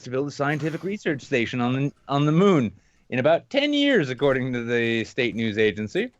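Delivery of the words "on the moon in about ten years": ordinary talk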